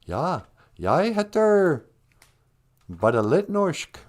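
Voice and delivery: slow, careful learner's Norwegian; speaking Norwegian. Shown as a demonstration. Showing off a little classroom Norwegian, slow and careful. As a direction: sound out the Norwegian slowly. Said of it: "a Norwegian".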